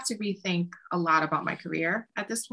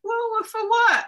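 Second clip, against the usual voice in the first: high, loud, laughing question; pressing the producer, laughing; laughing disbelief; 6 dB louder than usual. Retold or imitated. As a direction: demand details through laughter.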